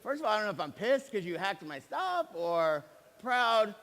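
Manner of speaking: mock outrage at his son; exasperated dad. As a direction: torn between pissed and proud. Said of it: "I'm like".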